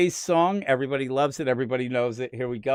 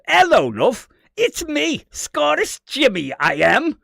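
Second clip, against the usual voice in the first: high, loud hammy Scottish accent; over-the-top mock Scottish, pitched way up; Scottish impersonation; 12 semitones higher, 8 dB louder, slower than usual. Shown as a demonstration. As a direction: do a cartoonish Scottish greeting. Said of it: "Kane did like my Scottish impersonation".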